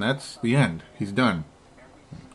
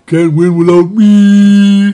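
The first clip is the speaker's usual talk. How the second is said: Shaq impression; 7 semitones higher, 12 dB louder, much slower than usual. Podcast host doing Shaq for his co-host, slow and booming. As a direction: boom it out slowly like Shaq. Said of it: "Worst Shaq impersonations".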